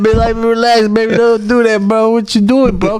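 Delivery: high, pleading mock coaxing; pleading boyfriend. Man begs his girlfriend not to call police, in high mock pleading. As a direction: coax her to calm down, pitched high.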